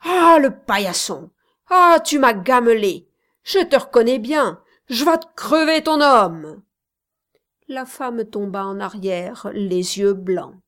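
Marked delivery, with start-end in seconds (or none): 0.0-6.6 loud furious yelling; hit hard crying out. Acted. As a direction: roar it at the woman. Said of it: "en hurlant".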